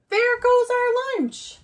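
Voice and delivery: high, loud dismayed mother; mother crying out. A narrator's reading. Mother sees lunch flying, crying out high and loud. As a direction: wail it like a mom losing lunch. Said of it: "the mother cried".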